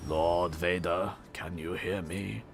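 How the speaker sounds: slow, low, hushed British officer; Imperial officer. Acted. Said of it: "Star Wars Imperial Officer".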